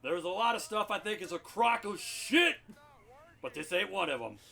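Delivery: gruff bellowing army colonel; Frank Slade. Acted. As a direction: roar it like a blind old colonel. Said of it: "Lieutenant Colonel Frank Slade".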